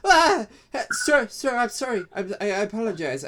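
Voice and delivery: high, loud flustered apology, fast; startled kobold. Acted. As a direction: yelp then gush apologies. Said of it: "after you scream, and she goes".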